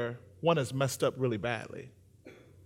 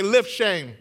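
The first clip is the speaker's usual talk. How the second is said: louder, punchy call to the crowd; shouted rallying cry; 6 semitones higher, 8 dB louder than usual. Shown as a demonstration. Preacher getting the congregation to shout it back, shouting. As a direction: shout it so everybody joins in. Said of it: "Everybody shout it".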